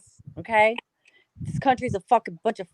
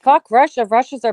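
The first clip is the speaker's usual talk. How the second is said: loud outburst; 10 dB louder than usual. Real, not acted. Sudden outburst.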